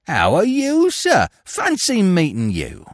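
high-pitched, over-eager fake delight; feigned joy. A narrator's reading. Pickpocket greets the officer he dreads, feigned joy. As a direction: gush at an unwelcome acquaintance. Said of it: "he exclaimed with feigned joy".

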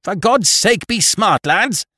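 high, loud urgent roar; captain urging his crew, high and loud. A narrator's reading. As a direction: bark it at the men to hurry. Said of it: "roared the captain".

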